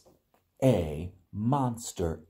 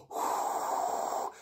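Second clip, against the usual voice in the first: blowing sound; 7 dB quieter than usual. Shown as a demonstration. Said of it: "can you blow?".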